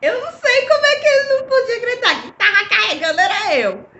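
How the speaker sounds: high, loud words through laughter; laughing. Real, not acted. Mocking her husband's disbelief while laughing. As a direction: talk while cracking up.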